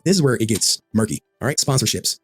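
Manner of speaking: ordinary talk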